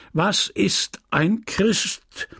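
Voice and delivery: slow, high catechism chant; booming priest. A narrator's reading. Priest quizzing children in church, slow ringing call. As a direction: ring out the question, drawn out and high. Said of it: "die dröhnende Stimme des Pfarrers".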